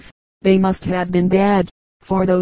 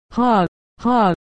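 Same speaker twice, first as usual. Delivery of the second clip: a light acted "ha, ha" laugh, slightly higher; mock laughter; 3 semitones higher than usual. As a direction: chuckle it out like a gentleman amused. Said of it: "I think I shall die with laughing".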